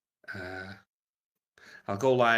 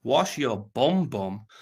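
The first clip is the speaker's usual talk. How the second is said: ordinary delivery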